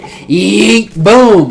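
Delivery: high drawn-out "yeet", then loud boom; punchy spoken sound effects; imitated pole strike. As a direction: voice the whoosh and impact. Mimics pole extending and hitting.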